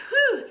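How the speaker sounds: loud, high falling whew; exertion whew. Real, not acted. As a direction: puff out the effort loudly and high. Straining through plank tucks.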